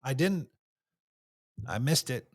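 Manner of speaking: low, soft, halting confession; vulnerable admission. Shown as a demonstration. Admitting a missed task to someone, vulnerable and quiet. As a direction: drop the voice and own it humbly. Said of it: "lead with that vulnerability".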